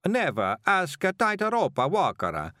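slow, high mock Italian accent; fake Italian accent. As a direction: ham up a showy Italian accent. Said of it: "he said it like this".

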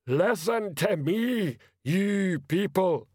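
booming troll yelling at the crowd; troll shouting. A narrator's reading. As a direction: call out over a riot like a slow troll. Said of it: "A troll shouting at the top of his voice".

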